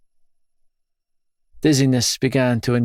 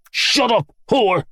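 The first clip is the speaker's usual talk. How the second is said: high snarling roar at his wife; 10 semitones higher, slower than usual. Acted. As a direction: bark it at her in rage. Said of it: "Dad roared".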